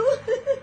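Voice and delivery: short, high mocking giggle; sarcastic giggle. Villainess giggles at the doomed doctor. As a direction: giggle at his fate. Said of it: "rindo com sarcasmo".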